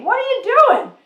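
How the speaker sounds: shouting at the TV. Retold or imitated. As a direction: yell it at the screen. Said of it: "I was just shouting at the T V".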